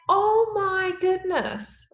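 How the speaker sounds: high, slow amazed gasp; awestruck. Retold or imitated. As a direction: breathe it out in wonder. Awestruck quote about turtles.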